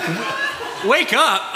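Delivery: laughing, then a sudden loud high "wake up"; mock call to wake up. Pastor teasing the congregation, loud laughing wake-up call. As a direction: jolt the sleepy room awake with a loud call. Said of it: "Now everyone's like".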